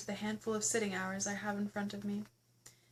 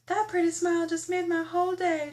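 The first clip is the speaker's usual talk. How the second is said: lightly voiced exclaim; 6 semitones higher, 6 dB louder than usual. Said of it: "she exclaims".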